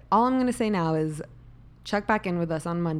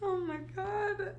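high squeaky laugh-crying; 12 semitones higher than usual. Real, not acted. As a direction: wheeze through tears of laughter. Collapsing in laughter over retakes.

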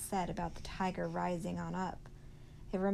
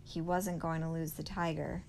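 usual speaking voice

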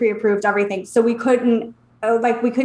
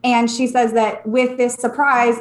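ordinary delivery

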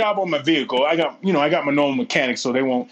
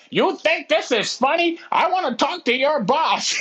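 put-on high, slow, indignant old-man voice; old man's fit; 10 semitones higher, slower than usual. Old man catches the manager smirking, mock outraged fit. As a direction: imitate an old customer throwing a fit, drawn out and high. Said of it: "the old man starts throwing a fit".

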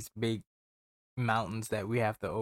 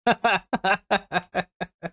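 loud high burst of laughter; 10 semitones higher, 6 dB louder than usual. Real, not acted. Listener laughing at the shared gripe, loud laugh.

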